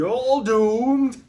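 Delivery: slow ominous doomsayer warning; Crazy Ralph. Retold or imitated. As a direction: drag it out high and loud like a crazed prophet. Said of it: "Crazy Ralph there on his bike".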